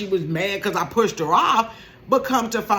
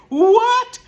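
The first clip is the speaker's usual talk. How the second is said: high loud shocked what; 12 semitones higher, 9 dB louder than usual. Retold or imitated. Storyteller stunned at her sister's news, high and loud. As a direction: bark it out in disbelief.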